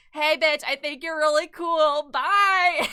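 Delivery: high, loud, gushing fan voice; blurting it out then fleeing; excited gushing. Retold or imitated. Fan gushing to an artist she admires, loud and high. Note left at artist's table.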